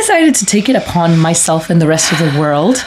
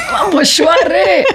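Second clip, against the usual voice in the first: high, slow, laid-back Swahili greeting; Mombasa street greeting; 12 semitones higher than usual. Shown as a demonstration. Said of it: "when you in Mombasa".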